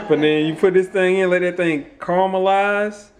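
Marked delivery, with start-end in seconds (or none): 2.0-3.1 caramelize said "CAR-muh-lize"; deliberate pronunciation. Shown as a demonstration. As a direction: say caramelize with an opening "car", higher pitched. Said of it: "Y'all heard what I said".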